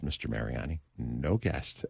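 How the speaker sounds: low, soft headset voice; flat producer warning. Retold or imitated. Producer telling the host through headsets, low and soft. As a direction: deliver bad news quietly in his ear.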